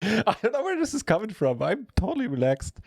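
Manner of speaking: high, laughing protest. Real, not acted. Laughing second take of the line.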